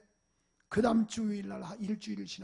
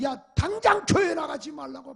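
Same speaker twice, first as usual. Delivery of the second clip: loud, higher scolding command; angry order; 6 semitones higher, 9 dB louder than usual. Retold or imitated. 교회 간 걸 안 아버지가 아들에게 호통치듯, 화난 명령. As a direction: bark the ban like a furious father. Angry father forbids church.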